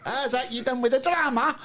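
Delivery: hammed-up Scottish accent; mock Scottish accent. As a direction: put on a broad Scottish voice. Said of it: "Where are they from? Brigadoon?".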